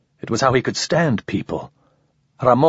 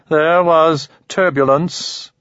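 slow, high, otherworldly alien; measured alien, unhurried and odd; 7 semitones higher, 3 dB louder, slower than usual. Acted. Alien speaks to Ramon.

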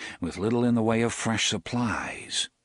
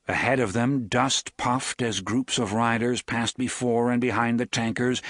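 usual speaking voice

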